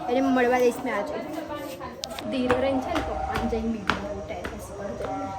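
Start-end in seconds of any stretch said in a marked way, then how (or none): 2.3-5.3 soft, low sneaking-up voice; sneaking hush. Real, not acted. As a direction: creep up on papa quietly. Sneaking up on papa. (પપ્પાને ચમકાવવા ધીમેથી બોલવું)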